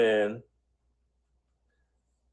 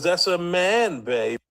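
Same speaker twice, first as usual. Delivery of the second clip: mock Austin Powers; 5 semitones higher, slower than usual. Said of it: "who talks like that".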